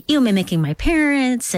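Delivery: normal talking voice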